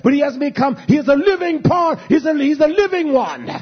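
rising sermon fervor; fiery preaching. Acted. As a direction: preach it hard to the congregation. Preaching at full force.

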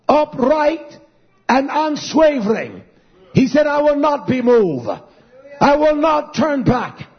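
high, emphatic pulpit delivery, rising as it goes; fervent, insistent proclamation; preaching building to climax. Acted. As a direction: hammer out short phrases with pauses.

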